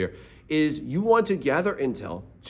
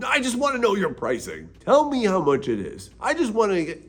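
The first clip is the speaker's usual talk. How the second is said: higher, pressing mock demand; pushy client; 6 semitones higher than usual. Artist imitating a forceful client demanding rates, pushy. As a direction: play the pushy client who wants the price now. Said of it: "clients are super forceful".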